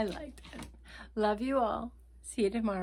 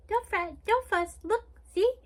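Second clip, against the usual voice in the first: much higher squeaky little mouse voice, rising as it goes; 11 semitones higher, 5 dB louder than usual. Acted. Little mouse comforting the crying bear, squeaky and much higher. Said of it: "Mouse squeaks".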